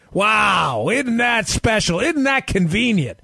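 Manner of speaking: mock amazement, big drawn-out wow; sarcastic marvelling. Real, not acted. Host mocking the supposed Putin's niece introduction, sarcastic.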